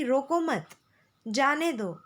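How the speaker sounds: रोको मत as one phrase, break, falling जाने दो; pause after मत. Shown as a demonstration. Teacher explains the meaning, pausing after मत. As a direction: say रोको मत together, stop, then जाने दो. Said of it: "इसका अर्थ ये होता है".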